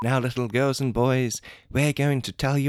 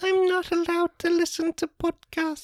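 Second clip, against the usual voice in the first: put-on high squeaky child voice; little kid voice. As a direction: play a small kid. Child character.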